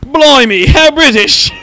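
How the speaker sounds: loud, high hammed-up British exclamation; exaggerated British. Trading Britishisms with the co-host, exaggerated British accent. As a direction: cry out in comic British astonishment. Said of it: "That's amazingly British".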